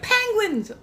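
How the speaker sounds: high, eager cry of the name; excited shout. Retold or imitated. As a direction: call it out gleefully. Community calling out.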